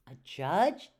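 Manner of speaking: loud questioning echo with a sharp rise; puzzled echo. A narrator's reading. Amelia questions Miss Edwards about the judge, puzzled. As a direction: read it as a surprised question.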